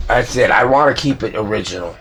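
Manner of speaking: normal talking voice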